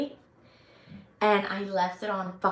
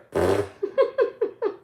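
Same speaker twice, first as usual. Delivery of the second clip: low laugh; 12 semitones lower than usual. Real, not acted. Laugh after story.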